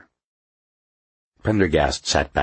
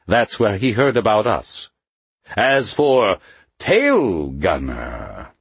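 Mephisto's voice; 6 semitones higher, 3 dB louder than usual. A narrator's reading. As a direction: hold a higher, harder voice. Mephisto voiced, raised pitch.

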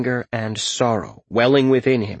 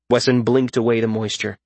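plain reading voice

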